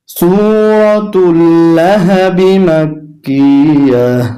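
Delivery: slow, melodic tilawat; drawn-out, sung Quranic recitation. Shown as a demonstration. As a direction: chant the surah slowly and devoutly. Full surah recitation.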